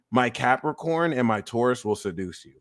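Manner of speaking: usual speaking voice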